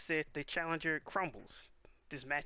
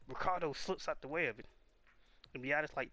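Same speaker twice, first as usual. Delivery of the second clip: ordinary delivery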